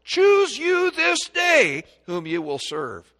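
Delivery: high, slow, ringing call to choose; solemn proclaiming. Acted. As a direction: thunder it out slowly like a prophet. Preacher quotes Joshua's challenge.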